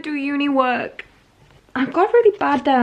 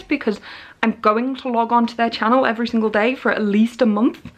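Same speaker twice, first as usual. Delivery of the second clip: usual speaking voice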